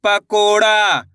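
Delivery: दरोगा drawn out very slowly; teacher blending syllables slowly for kids; slowly blended word. Shown as a demonstration. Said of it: "प को ड़ा".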